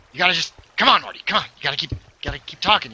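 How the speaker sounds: a light Rick voice, fast and pushy; Rick impression. Streamer tries Rick again, lightly voiced and hurried. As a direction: urge the kid on like a hasty old scientist. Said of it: "I thought I was doing a pretty good impression".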